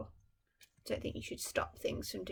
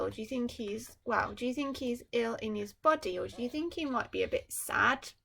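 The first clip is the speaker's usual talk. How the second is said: usual speaking voice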